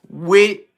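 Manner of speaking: "wit" for "with", ending on a hard t; "wit" set off alone, loud, Philly ordering style. Shown as a demonstration. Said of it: "If you don't know what wit means".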